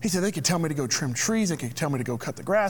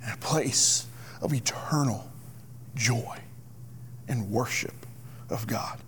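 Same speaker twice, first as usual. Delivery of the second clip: low, near-whispered preaching; reverent hush; 6 semitones lower, 6 dB quieter than usual. Real, not acted. Preacher describing eternal joy and worship, in a reverent hush. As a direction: let it sink low and hushed, with pauses.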